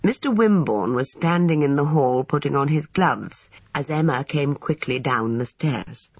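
usual speaking voice

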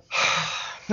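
long exasperated sigh; wordless, breathy sigh. Acted. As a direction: let out a heavy breath. Waiting for absent head of chambers.

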